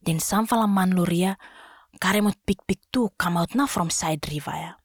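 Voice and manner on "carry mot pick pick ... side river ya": plain reading voice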